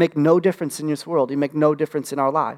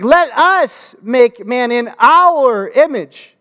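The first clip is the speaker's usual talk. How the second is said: slow, higher and louder, booming divine decree; hammed-up voice of God; mock grand God voice; 6 semitones higher, 6 dB louder, much slower than usual. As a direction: draw it out like a grand proclamation.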